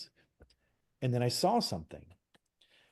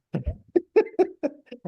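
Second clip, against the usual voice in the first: high burst of laughter; 4 dB louder than usual. Real, not acted. Laughing at own joke.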